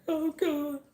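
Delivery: high, loud "Oh God" of alarm; shocked gasp. Retold or imitated. Seeing all the thermostat wires pulled out, a high alarmed cry. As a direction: react as if watching a disaster unfold. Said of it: "and I was like".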